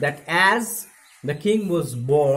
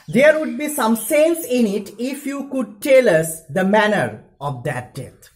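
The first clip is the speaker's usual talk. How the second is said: higher, louder, a hint of a small child; lightly voiced infant, raised and bold; little squeaky voice; 6 semitones higher, 7 dB louder than usual. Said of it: "in his little squeaky voice".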